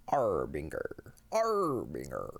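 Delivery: slow, low Harbinger, lightly voiced pirate. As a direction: drag out Harbinger like a hammy pirate. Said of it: "Say it like a pirate".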